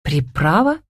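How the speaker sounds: uncomprehending echo question; puzzled. A narrator's reading. Camila asking back about a riddle, puzzled. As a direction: ask it back, baffled. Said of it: "непонимающе переспросила Камила".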